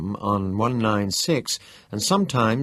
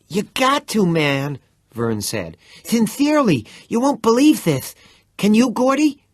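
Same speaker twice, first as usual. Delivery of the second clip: high, loud urgent pleading; excited pleading; 12 semitones higher, 6 dB louder than usual. Acted.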